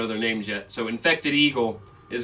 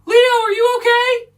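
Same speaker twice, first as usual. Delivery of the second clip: very high, loud frantic yell; 9 dB louder, slower than usual. Acted. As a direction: call out in alarm to a friend across a distance. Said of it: "he's yelling".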